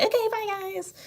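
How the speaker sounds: very high-pitched giddy goodbye; giggly sign-off. Retold or imitated. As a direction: squeal a mock-cheerful farewell, imitating your giggly self. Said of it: "all laughing and giggling".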